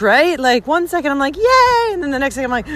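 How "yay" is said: a much higher burst of joy; joyful yay. Retold or imitated. One second elated in the storm, high joyful yay. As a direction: squeal it out happily.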